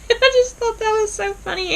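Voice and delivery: laughing through the words, pitched high; tickled, high-pitched laughing delivery; amused laughter. Real, not acted. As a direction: speak through a burst of giggles. Amused after retelling the funny exchange.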